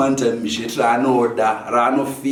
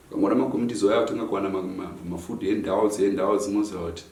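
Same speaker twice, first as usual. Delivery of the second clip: ordinary delivery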